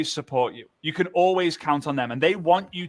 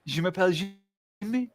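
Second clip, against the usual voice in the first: mock French; 3 semitones higher, 4 dB quieter than usual. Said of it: "It's like".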